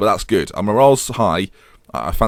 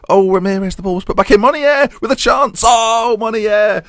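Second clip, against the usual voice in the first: excited commentary; 13 semitones higher, 5 dB louder than usual. Real, not acted. Live goal commentary rising.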